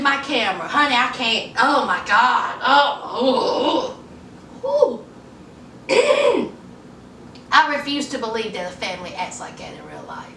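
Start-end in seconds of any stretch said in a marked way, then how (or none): 4.6-6.5 high, amused ooh-ooh; laughing ooh. Real, not acted. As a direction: squeal it out while laughing at the awful acting. Laughing at terrible show.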